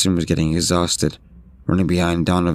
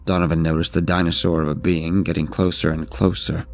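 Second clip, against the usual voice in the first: ordinary delivery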